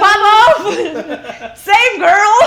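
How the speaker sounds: high, loud laughing cheer; loud high laughing hype shout. Retold or imitated. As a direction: hype up a coworker across the kitchen. Cheering the screaming cook.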